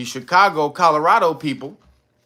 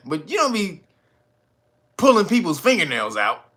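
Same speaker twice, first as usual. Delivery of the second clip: ordinary talk, nothing marked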